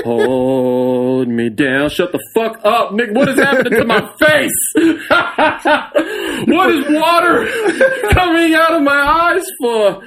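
high, laughing mock sob; laughing through mock tears. Friend retells crying at the movie, laughing high.

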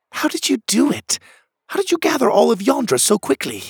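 very high, amazed questioning voice; high-pitched astonished character; disbelieving Pockets. Acted. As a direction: marvel in disbelief, the second question quick.

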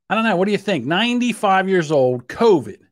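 normal talking voice

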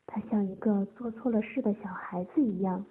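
plain reading voice

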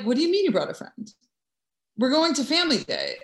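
high, fast and disbelieving; incredulous brother. Retold or imitated. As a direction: fire it off like a baffled older brother. Brother reacts to unexpected guest.

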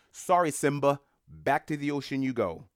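loud, breezy fake-sorry send-off; mock apology. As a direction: sarcastically wave them back to sea. Mocking countries turning refugees away.

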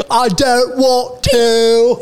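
put-on high, drawn-out British accent; hammed-up British refusal, slow and high; mock British rocker. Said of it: "and they said".

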